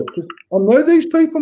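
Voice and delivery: ordinary talk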